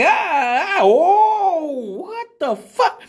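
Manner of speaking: gasps climbing high into a slow what the fuck; shocked audience gasp. Retold or imitated. The whole crowd reacting to the ice cream flicking, shocked high gasps. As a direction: play a crowd recoiling in disgust.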